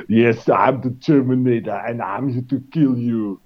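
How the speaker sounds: lightly put-on Austrian accent, English words; hint of Arnold's Austrian accent in a mock Terminator line; Schwarzenegger impression. Mocking how the Terminator sounds in English, light Austrian accent. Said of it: "talking like this".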